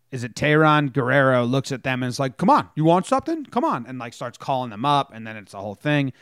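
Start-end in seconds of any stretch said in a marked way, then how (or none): 2.4-3.8 high, goading call; mock taunt, pitched up; taunting challenge. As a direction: dare them to come over. Player taunting the dugout.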